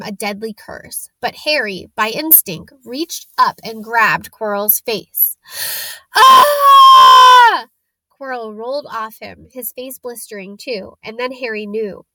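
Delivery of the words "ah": very high, loud scream of pain; a piercing howl of agony; agonized scream. Acted. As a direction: shriek as if burned. Quirrell burned by Harry's touch.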